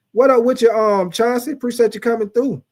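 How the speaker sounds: high, loud shout-out; excited greeting. Real, not acted. Host welcoming Chauncey to the stream, excited. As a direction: welcome him in with energy.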